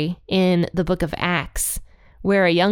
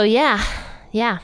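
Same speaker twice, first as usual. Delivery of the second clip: ordinary talk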